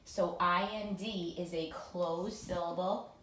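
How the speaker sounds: ordinary talk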